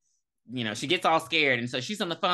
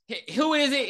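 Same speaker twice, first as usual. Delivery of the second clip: scared; 8 semitones higher, 3 dB louder than usual. Retold or imitated. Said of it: "She all scared".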